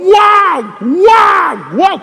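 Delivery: high, loud pleading calls; a voice in his ear shouting stop; urgent warning shout. Retold or imitated. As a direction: cry out to stop him. Inner voice warning him.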